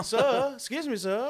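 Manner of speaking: high, loud mock British vendor; hammed-up British call for attention; British accent. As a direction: pipe up as an insistent British seller. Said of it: "gave him a British accent".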